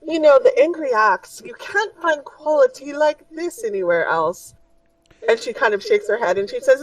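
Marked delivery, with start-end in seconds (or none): 0.0-4.6 a dainty merchant offering a fix; high shopkeeper voice. Acted. Shopkeeper assuring the party, high and fussy.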